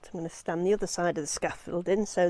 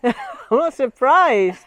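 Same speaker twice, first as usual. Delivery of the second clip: laughing; 4 semitones higher, 6 dB louder, slower than usual. Real, not acted. Laughing with the climber.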